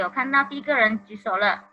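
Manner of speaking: ordinary delivery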